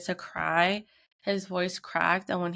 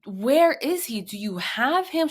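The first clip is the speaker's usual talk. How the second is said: raised, loud imitation of a frantic parent; urgent demand; 5 semitones higher, 4 dB louder than usual. Searching father questions the little girl, urgent demand.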